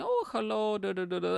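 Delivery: a hint of a high, chirpy passenger hello; polite British greeting. Imitating passengers greeting the driver, lightly high and chirpy. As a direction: lightly mimic cheerful bus passengers. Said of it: "they were saying".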